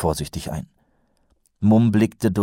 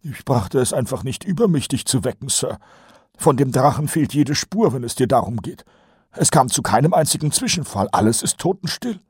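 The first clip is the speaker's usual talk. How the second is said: higher, nasal-free eager sergeant voice; Kolon's voice; 8 semitones higher than usual. A narrator's reading. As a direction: play the chatty old sergeant, pitched up. Colon voiced by narrator. (höher gesprochener Feldwebel Kolon)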